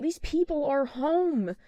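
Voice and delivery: high, slow, stretched-out pleading; mortified protest. Retold or imitated. Niece stopping aunt outside strangers' house, high slow mortified protest. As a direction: draw it out in mock embarrassment.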